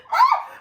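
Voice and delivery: very high, loud squeal; delighted squeal. Real, not acted. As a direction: shriek with giddy joy. Squeal of delight over trope.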